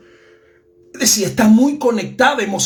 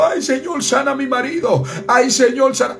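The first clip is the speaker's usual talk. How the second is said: imitating tearful pleading; 8 semitones higher than usual. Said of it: "tú ves las mujeres llorando".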